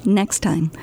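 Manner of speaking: ordinary delivery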